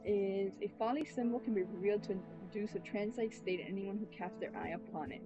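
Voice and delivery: ordinary talk, nothing marked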